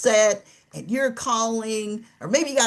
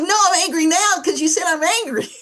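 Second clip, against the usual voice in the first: high, loud, fast retort; hammed-up exasperated snap; mock angry outburst; 11 semitones higher, 5 dB louder, faster than usual. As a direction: blow up at being told you're angry. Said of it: "Now you're angry".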